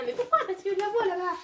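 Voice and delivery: voz de niña aguda; high child voice. Acted.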